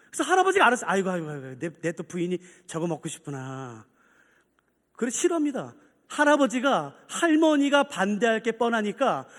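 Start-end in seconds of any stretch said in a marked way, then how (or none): 0.9-3.8 low, soft grandfatherly musing; wistful old husband. Retold or imitated. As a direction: murmur it low, with tender regret. Grandfather sighing over wife's wish.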